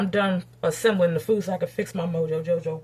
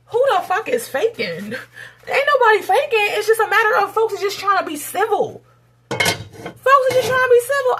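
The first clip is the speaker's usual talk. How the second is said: indignant retort; 12 semitones higher, 7 dB louder than usual. Acted. Answering people who say she was faking, indignant. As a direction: fire back at the accusers, voice pitched way up. Acted retort to imagined accusers.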